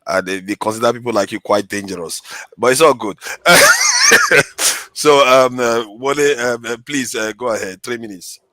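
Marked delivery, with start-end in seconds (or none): 3.2-4.9 sudden shrieking laugh; loud burst of laughter. Real, not acted. Host laughs at own joke.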